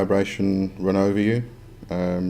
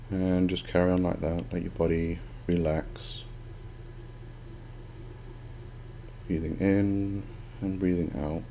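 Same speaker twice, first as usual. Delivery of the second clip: soft spa whisper; 6 dB quieter than usual. Acted. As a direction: guide the breathing gently, unhurried. Leads breathing meditation.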